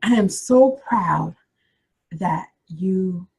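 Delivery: drawn-out words with a pause midway; slow dictation. Shown as a demonstration. Coach repeating the letter line for attendees, slow and deliberate. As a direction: dictate slowly, leaving room to write.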